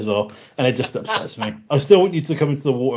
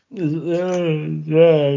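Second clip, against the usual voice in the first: embarrassed; 6 semitones higher, 5 dB louder than usual. Real, not acted. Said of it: "I'm so embarrassed".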